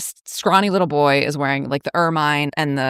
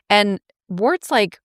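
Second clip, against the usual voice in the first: usual speaking voice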